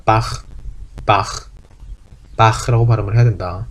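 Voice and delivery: "바흐" with a throaty ch, no added vowel; German ach-sound, Bach. Shown as a demonstration. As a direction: say "바흐" the German way, ending in a rasp. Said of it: "바하 혹은 바흐라고 하지 않고".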